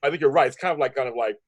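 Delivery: usual speaking voice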